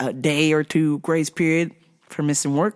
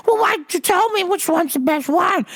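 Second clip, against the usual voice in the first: high, squeaky old lady voice imitation; mock customer; 12 semitones higher than usual. Retelling the customer at the store, mock old lady voice. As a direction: mimic the pushy old customer, higher.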